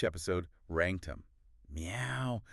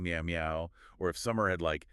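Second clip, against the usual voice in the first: ordinary talk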